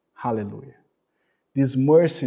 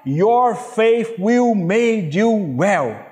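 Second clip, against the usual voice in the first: higher, slow, full-force proclamation; preached declaration; 7 semitones higher, 4 dB louder, slower than usual. Real, not acted. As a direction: declare it over them like a prophecy. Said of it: "I would like to declare this word upon your life".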